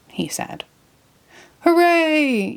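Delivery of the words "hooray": much higher joyful yell; cheer. Acted. Patrick grabs the package, high joyful cheer. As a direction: whoop with delight. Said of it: "yelled Patrick".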